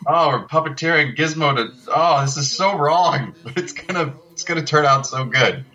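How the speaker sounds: laughing through mock guilt about doing something wrong; guilty second thoughts. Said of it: "We all looked at each other like".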